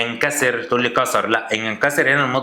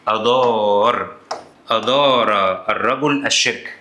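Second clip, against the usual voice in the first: slow answer; slower than usual. Retold or imitated. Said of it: "هقول لك".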